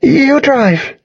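high-pitched, urgent female character voice; insistent. A narrator's reading. Girl insisting the narrator drive, insistent. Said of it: "she insisted".

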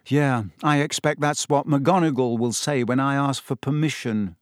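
ordinary delivery

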